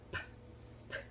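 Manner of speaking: "P, p" as voiceless pops, no vowel; mute P. Shown as a demonstration. Teacher isolating the mute consonant, voiceless pops. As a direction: pop the lips on "P", pause, repeat. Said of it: "vou fazer o som do P mudo".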